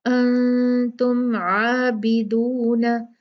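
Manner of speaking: आबिदून with the ain sounded clearly. Shown as a demonstration. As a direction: make the ain in आबिदून distinct. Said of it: "आइन को वाजे करना है". (आबिदून में ऐन साफ़)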